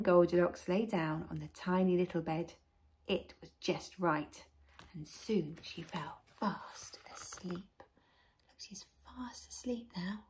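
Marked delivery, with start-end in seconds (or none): 4.9-7.6 slow, soft whisper; hushed. A narrator's reading. Hushed as Goldilocks falls asleep. 7.8-10.2 very soft confiding whisper. A narrator's reading. Whispers beside sleeping Goldilocks.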